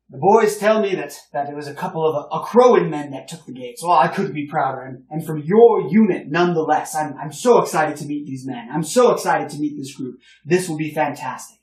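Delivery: put-on older gentleman greeting the heroes, loud and hearty; boisterous older gentleman.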